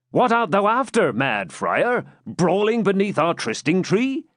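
scolding shout. A narrator's reading. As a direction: call him off sharply. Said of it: "cried the captain".

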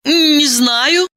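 high unsure reply; shrugging answer. Acted. Diana voice, high.

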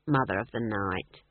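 low, cold mocking title; low sneer. Leader mocks Granny.

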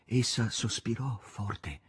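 usual speaking voice

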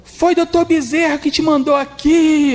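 high, slow, loud solemn proclamation; mock mystic lady. Lady at the centre telling Luiz a spirit sent him, high and slow. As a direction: announce it like a revelation.

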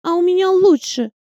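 put-on high, cheeky child's boast; a little girl outdoing friends. A narrator's reading. Girls boast about dolls.

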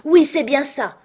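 high, loud cry of recognition; exclaiming. A narrator's reading. Morgan agreeing with Silver as he gets up, loud exclamation. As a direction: burst out in excited agreement. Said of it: "s'écria Morgan".

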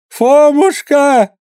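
очень высокий громкий протяжный зов; закричи, зовя на помощь; отчаянный зов. A narrator's reading. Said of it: "закричала она". (перепуганная мать зовёт Фомушку, громко)

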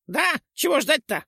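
put-on high girlish scoff. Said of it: "хмыкнула Чопля".

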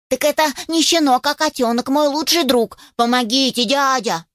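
high, urgent puppy voice; pleading puppy. Acted. Puppy explains his best friend needs help, pleading. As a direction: plead eagerly like a little puppy.

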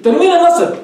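ordinary talk, nothing marked

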